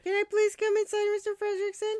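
high-pitched imitation of a polite little boy; pleading boy. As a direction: ask to be let in, voice pushed way up. Voicing Russell asking to be let in.

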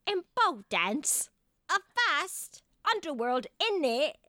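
a hint of a Cockney accent. Friend reading a quote in a Cockney accent.

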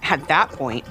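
usual speaking voice

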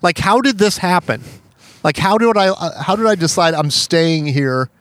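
high-pitched exasperated bewilderment; retold disbelief. Storyteller asking how the quarter standoff happened, retold disbelief.

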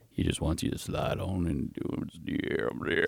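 breathy teasing whisper. Real, not acted. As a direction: whisper it like a secret. Teasing the sponsor line.

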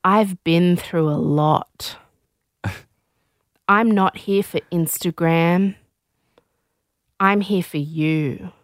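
imitating a solemn heartfelt declaration; mock earnest confession. Contestant to his date on camera, mock earnest. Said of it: "he looked at her and he said".

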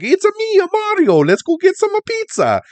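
high, loud cartoon Italian accent; over-the-top Italian plumber accent. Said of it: "stereotypical Italian".

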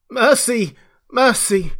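high, loud cry for mercy; wild scream. A narrator's reading. Beaten man begging the crowd, wild scream. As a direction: scream it out in desperate pleading. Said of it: "a wild scream of".

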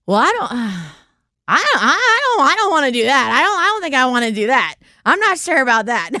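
hesitant, stumbling mock refusal; imitating drunken stammering. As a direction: stammer like a drunk who can't finish. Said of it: "you can't even, like, finish his sentence".

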